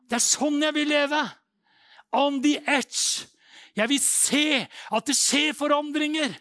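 high, roaring preacher's declaration; full-force preaching. Real, not acted. Preacher rouses the church against tidy little lives, shouting high. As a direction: roar it out with drawn-out stressed words.

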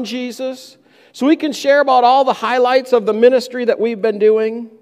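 high-pitched, fast, whiny complaint; annoyed disciples. Acted. Disciples complaining to Jesus about the crowds, high annoyed whine. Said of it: "you can almost hear in the disciples' voice a, a bit of annoyance".

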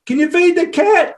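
high, drawn-out call back over the shoulder; slow, high-pitched mock holler; mock yell. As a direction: imitate kids calling from the door. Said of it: "they yell it out back to you".

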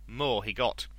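ordinary delivery

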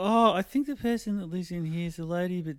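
high, light girlish mimicry; playful neighbor impression; girls' voice. Hosts voice the girls.

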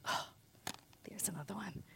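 breathy whispered aside. Real, not acted. Minister whispering an aside to the congregation.

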